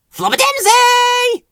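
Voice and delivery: very high, loud, drawn-out squeaky yell; squealed silly shout; shouted call. A narrator's reading. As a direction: holler it with a long last syllable. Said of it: "shouted Ben".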